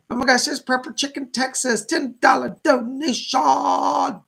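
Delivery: thrilled to spot a viewer's gift; excited shout-out. Real, not acted. Medium to livestream viewers, thrilled donation shout-out. Spots a donor in the chat.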